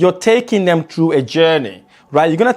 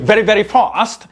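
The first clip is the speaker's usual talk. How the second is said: fast warrior tone; 4 semitones higher, 3 dB louder, much faster than usual. Shown as a demonstration. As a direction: rattle it off fast and firm. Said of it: "We have warrior sound".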